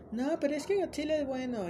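voz aguda de chica; Marcela imitated. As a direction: imitando a Marcela, agudo y suplicante. Marcela defiende a Godzilla. (voz aguda imitando a una chica)

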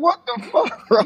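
high, disbelieving yelp with a laugh inside; shocked outburst. Retold or imitated. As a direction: squeak it out in outraged disbelief. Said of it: "I was like".

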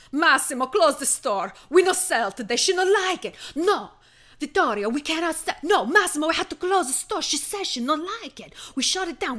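hammed-up Italian broken English, loud and high; panicked Italian shopkeeper. A host mocking Gucci staff who panic over one customer, hammed-up Italian accent. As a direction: play a frantic Italian store clerk shutting the shop.

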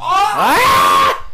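sudden loud startled cry; startled shout. Real, not acted. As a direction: jump at the scare. Startled by the scene.

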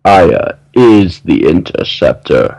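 slow, hammed-up menacing reveal; playfully menacing, slow and deliberate. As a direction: stretch each word out ominously. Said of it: "You said that very menacingly".

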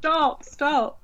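high pitched mock shout; mock yell stop. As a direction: cry out stop in mock panic. Reenacting the henchman scene.

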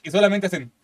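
usual speaking voice

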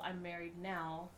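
ordinary talk

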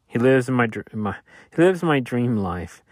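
ordinary delivery

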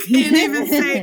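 breaking into laughter; real laughter; laughing. Real, not acted. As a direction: giggle through the words.

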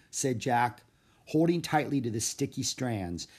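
usual speaking voice

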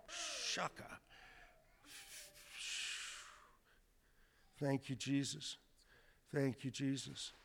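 soft murmured prayer; quiet prayer. Real, not acted. Preacher praying over the woman, soft and murmured. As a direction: pray softly under the breath.

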